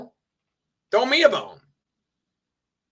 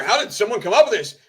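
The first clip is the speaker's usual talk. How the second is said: mocking quote; 4 semitones higher, 3 dB louder, faster than usual. Mockingly quoting chat member.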